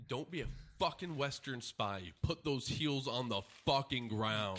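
hammed-up commanding shout; loud mock order. Mock-stern squatting orders.

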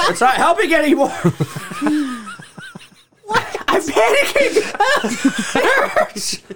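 high, strained laughing through pain. Real, not acted. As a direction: laugh in panic while it burns. In pain from spicy food.

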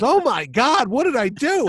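high, loud dismay; shocked Chaykin. Retold or imitated. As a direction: gasp it out, stunned and guilty.

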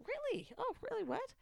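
hammed-up surprise, pitched high; mock disbelief.

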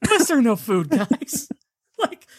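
high, laughing questions at the rats; laughing mock outrage. Real, not acted. Incredulous mock scolding of characters.

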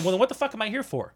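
quick, high-pitched retold exasperation; exasperated challenge, rising in pitch; pissed off. Contractor questioning his own role to electricians, pissed off. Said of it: "now I'm getting pissed off".

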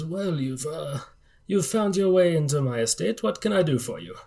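a hint of a regal old gentleman; lightly voiced rich old patriarch. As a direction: lightly play a wealthy host, softly.

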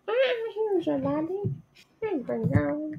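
high, soft cooing. Real, not acted. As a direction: coo at the kitty. Talking to the cat.

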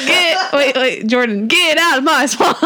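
put-on playful, overdone Shrek-style Scottish accent; mock Shrek Scottish accent. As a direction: imitate a friend's bad Scottish Shrek. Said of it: "Wait, is that his Scottish accent?".

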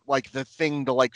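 normal talking voice

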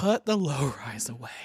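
breathy whispered plea; whispering. Real, not acted. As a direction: whisper it urgently.

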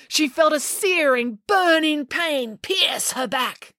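high, stretched-out storytelling; dramatic agony. A narrator's reading. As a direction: draw out every painful word. Narrator dramatizes the searing sting.

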